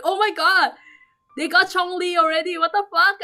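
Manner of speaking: high, loud, jealous-sounding outburst; angry disbelief. Retold or imitated. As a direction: exclaim in outrage at someone else's luck. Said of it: "And I'm so angry because".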